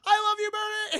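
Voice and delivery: high, loud mock yell; shouted farewell. Man shouting love as he drives away, high yell. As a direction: call it out loudly on the way off. Hero calls out while driving away.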